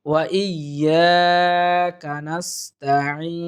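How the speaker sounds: very slow, long held syllables; stretched tajweed recitation. Shown as a demonstration. Teacher modelling the whole phrase, slow careful tajweed. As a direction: draw out "yaa" and the final "eeen". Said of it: "তিন আলিফ পরিমাণ টেনে".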